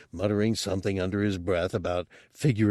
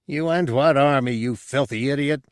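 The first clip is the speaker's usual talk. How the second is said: angry; 5 semitones higher, 3 dB louder than usual. Acted. Drunk Reis insulting Bubo.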